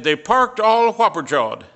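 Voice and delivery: "whopper-jawed" said high and loud as a new word; retold new word. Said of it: "he gave me this new big word".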